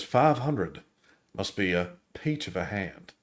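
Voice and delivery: put-on quiet Southern gentleman accent, lightly done; genteel Southern accent. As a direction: lightly voice Doc Holliday's Southern aristocrat. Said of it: "His accent is".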